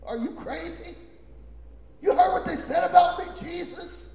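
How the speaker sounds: high, loud disbelieving outcry; mock outraged churchgoer; indignant protest. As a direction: protest to Jesus in shock. Voices a bewildered listener.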